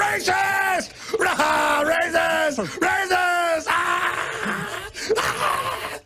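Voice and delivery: hammed-up shrieking accusation; mock screaming critic. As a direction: scream it like a hysterical heckler.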